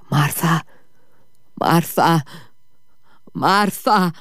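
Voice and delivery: a wounded man moaning his wife's name; strange groaning voice. A narrator's reading. Injured Grigory calls his wife from the garden, groaning. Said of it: "με μια παράξενη φωνή που έμοιαζε με βόγκο".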